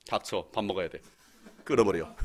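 low, curt dismissal; mock brush-off. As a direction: shut the caller down gruffly, as a joke. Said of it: "그때 내가 뭐라 해야 돼요?".

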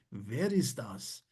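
Wer ist das said slowly and clearly; slow quiz question. Acted. Quiz host asks the contestant, slowly. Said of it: "diyor ki".

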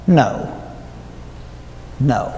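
steadily fading refusals; firm refusals. Retold or imitated. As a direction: decline each one calmly and finally. Refusing everyone else.

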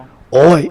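high, drawn-out "ow" cry; yelp of pain. A narrator's reading. Henchman hit in the face, high mock yell of pain. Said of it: "เสียงมันแหกปากร้อง".

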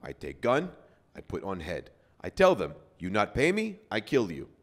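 put-on broken English in a Russian accent, rising to a loud finish; thick-headed tough guy's Russian-accented broken English. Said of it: "in broken English with a Russian accent".

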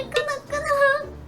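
high squeak for each nod; squeaky high voice. Acted. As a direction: chirp it up high. Squeaky nodding.

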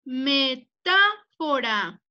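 "Metáfora" stretched out part by part; slow dictation. Shown as a demonstration. Teacher repeating a dictated word for pupils, slow dictation. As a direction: repeat "Metáfora" very slowly for writing down.

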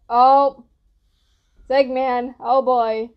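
usual speaking voice

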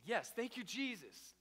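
mock-retold shout of thanks; relieved thanks. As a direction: cheer it out like an answered prayer. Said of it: "And we're thinking".

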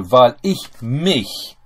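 slow, deliberate reading of the German clause. Shown as a demonstration. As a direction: spell the sentence out word by word, slowly. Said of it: "reads built German clause aloud".

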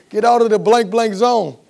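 loud, high censored outburst; retold full-voiced holler at the coach; choice words shouted. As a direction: yell it with blanks standing in for curses. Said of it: "give him a couple choice words".

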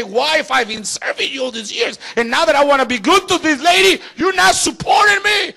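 rising into a high angry shout; angry complaint to God. Retold or imitated. Preacher voicing an angry believer, rising shout. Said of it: "stay angry with God and say".

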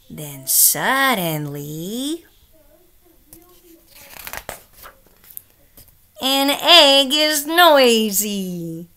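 slow, then loud and high on noisy; building to loud. A narrator's reading. Reader booming the noisy page for children. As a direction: swell from hush into a big noisy reveal. Book shifts from quiet to noisy.

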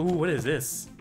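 ordinary delivery

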